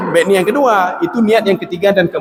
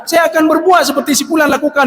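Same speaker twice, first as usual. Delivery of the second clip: envious outcry; 8 semitones higher than usual. Acted. Preacher voicing the envious man's prayer, shouting. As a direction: cry out the wish to copy the rich man. Man voicing envy of rich man.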